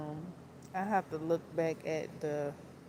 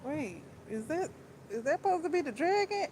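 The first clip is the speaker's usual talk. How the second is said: rising, quicker disbelief; surprised question; 8 semitones higher, faster than usual. Retold or imitated. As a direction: caught off guard, pitch jumping up. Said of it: "I'm like".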